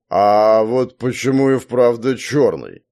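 put-on slow, wondering drunk question; puzzled. A narrator's reading. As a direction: wonder aloud, slowly puzzled. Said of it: "будто сам удивился".

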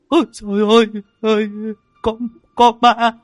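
shrill, very high, loud screams of terror; terrified screaming. Acted. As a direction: scream for help as if a ghost grabs you. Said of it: "Chúng nó gào thét lên".

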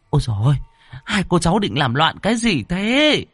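high, wailing alarm; aghast protest. A narrator's reading. Aunt rushes in to stop them opening the coffin, aghast. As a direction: cry out in dismay, stammering at the end.

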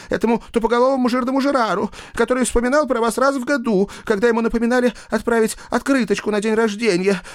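rapid, high agitated rant; carried away. A narrator's reading. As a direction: blurt out grievances in a rush. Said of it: "Но парня уже понесло".

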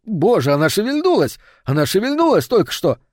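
sudden thrilled alarm; startled exclamation. Acted. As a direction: blurt it out in amazement. Startled cry that the planchette moved.

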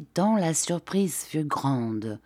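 ordinary delivery, nothing marked

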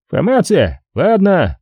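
high-pitched, curt desk cop; police phone voice. A narrator's reading. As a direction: answer high and dismissive, closing the matter. Police voice answers the caller.

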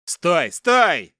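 loud, high shout to halt; desperate shout. A narrator's reading. Captive father calls back the departing captor, shouting. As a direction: call after him urgently. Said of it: "закричал Дмитрий Олегович".

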